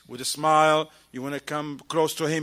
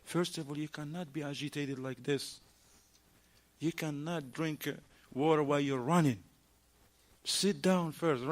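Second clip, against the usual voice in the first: calming a brother; 3 semitones lower, 8 dB quieter than usual. Retold or imitated. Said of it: "We said".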